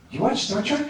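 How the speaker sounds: a shy fan blurting it out high; nervous high question. Retold or imitated. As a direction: voice jumps high and tentative. Nervously asking James Worthy.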